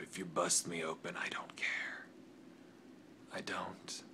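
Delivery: hushed, intense whispered vow; menacing whisper. Acted. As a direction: swear it under your breath. Threatening rival in promo.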